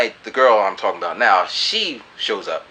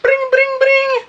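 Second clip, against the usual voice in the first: very high sung ring tone; phone ring imitation; 7 dB louder, slower than usual. The girl calling him the next night, a high ringing phone.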